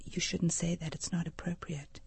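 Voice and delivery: soft whispered reprimand from a mother; hushed correction. Retold or imitated. Mom corrects her son in the checkout line, hushed. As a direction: correct him discreetly under your breath.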